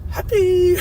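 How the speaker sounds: very high squeaky tipsy voice; drunk happy squeal. Retold or imitated. Retelling his tipsy wife high-fiving him, squeaky high squeal. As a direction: squeal it like a giddy drunk friend. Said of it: "She's like".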